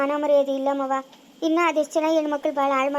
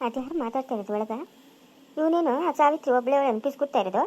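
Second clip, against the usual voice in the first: ordinary talk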